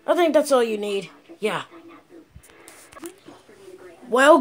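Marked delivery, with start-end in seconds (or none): none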